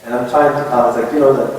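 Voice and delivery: normal talking voice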